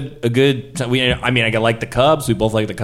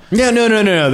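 flustered chatter; 11 semitones higher, 4 dB louder than usual. Acted. Flustered friendly chat with killer.

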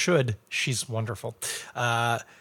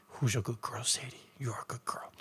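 soft, near-whispered praise for a beloved dog; tender dog talk; 10 dB quieter than usual. Pretend. Host remembering brewery dog Sadie, tender soft murmur. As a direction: murmur it softly to a good dog.

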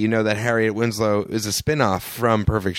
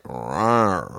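dinosaur voice; 4 semitones lower than usual. Retold or imitated. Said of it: "and he's like".

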